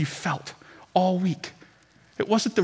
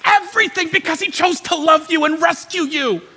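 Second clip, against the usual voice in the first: much higher and louder, slow shouted proclamation; preaching at full force; 13 semitones higher, 10 dB louder, slower than usual. Real, not acted. Preacher shouting the climax to the congregation, full force preaching. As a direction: roar it to the back of the church.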